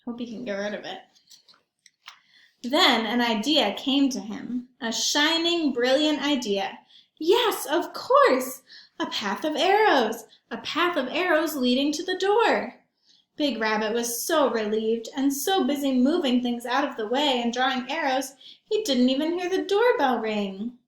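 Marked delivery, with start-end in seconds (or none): 2.6-6.8 put-on lively read-aloud for kids; storybook narration. A narrator's reading. Reads picture book aloud. 7.2-8.6 eureka delight. Acted. Rabbit gets a brilliant idea. 9.0-20.7 put-on lively read-aloud for kids; storybook narration. A narrator's reading. Reads picture book aloud.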